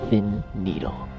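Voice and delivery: ordinary talk, nothing marked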